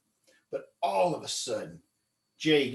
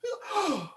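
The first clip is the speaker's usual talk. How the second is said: gasp; 4 dB quieter than usual. Retold or imitated. Bill hears the fish bark.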